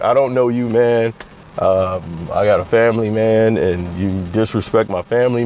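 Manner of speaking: LeBron impression. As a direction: answer low and casual.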